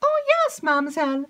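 high, loud eager maid's voice; breathless excited maid. Acted. Maid rushes in to tell Emily news, excited.

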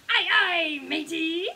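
loud, high pirate reply; little pirates. A narrator's reading. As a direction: answer like an eager pirate crew. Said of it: "say her little pirates".

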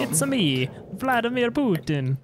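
mock Russian leader, slow on the name; comic Russian-accented self-introduction, pitch climbing; Vladimir Putin. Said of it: "you were trying to do Russian".

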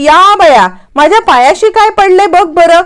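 high, sing-song feigned surprise; a hammed-up innocent "oh my, look here"; sly mock innocence. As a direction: play the sly sister-in-law pretending to help. Bhavana feigns finding paper.

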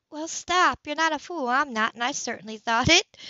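Anna's voice. Acted. Anna scolds Grace kindly.

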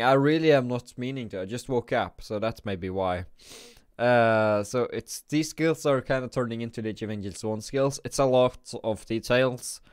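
ordinary delivery

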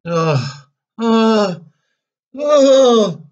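three groans climbing to a high yell; cricket's escalating howl of dismay; exasperated cry. A narrator's reading.

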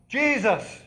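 loud, high call for Jesus. Retold or imitated. Crowd calling to Jesus, loud high call. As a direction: call out across the road. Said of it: "they call out".

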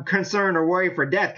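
ordinary delivery

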